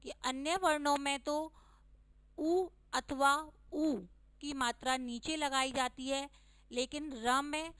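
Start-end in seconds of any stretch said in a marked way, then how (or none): 2.4-3.9 उ अथवा ऊ drawn out slowly, each vowel held; slow careful emphasis. Shown as a demonstration. Teacher stressing vowel signs slowly to children. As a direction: spell out उ and ऊ slowly for the class. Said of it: "तो बच्चों ध्यान रखिएगा".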